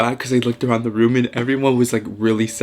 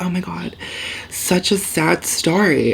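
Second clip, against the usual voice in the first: drawn-out, higher-pitched mock tragedy; dramatic; 6 semitones higher than usual. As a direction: imitate her dramatic sorrow, slow and high. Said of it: "like, dramatic".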